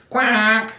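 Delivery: high, loud duck quack. A narrator's reading. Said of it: "The duck answered".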